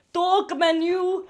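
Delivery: slow, measured Icelandic-accented reading; demonstrative Icelandic accent. Shown as a demonstration. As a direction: read it out slowly in an Icelandic accent. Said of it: "read it in my accent".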